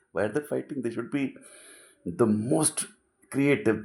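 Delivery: usual speaking voice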